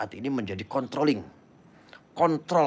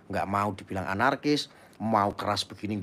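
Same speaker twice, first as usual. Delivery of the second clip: ordinary talk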